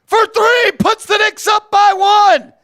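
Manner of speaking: thrilled announcer shout; excited shout. Real, not acted. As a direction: yell the go-ahead three. Go-ahead three made.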